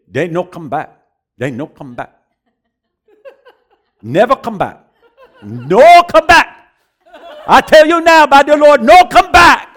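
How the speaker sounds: loud, high, halting broken English; imitating the Singapore preacher. Preacher imitating the Singapore man's prophecy, loud and halting. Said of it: "That's how he says".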